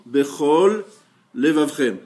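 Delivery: BECHOL, pause, LEVAVREM, each drawn out on its own; carefully separated words. Shown as a demonstration. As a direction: say "Bechol" and "Levavrem" as two distinct, deliberate words. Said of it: "Si vous dites rapidement".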